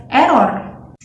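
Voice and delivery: 'error' with short, clean tongue-tap R; Indonesian-style 'error', pronounced as spelled; Indonesian accent. Shown as a demonstration. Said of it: "in Indonesian accent".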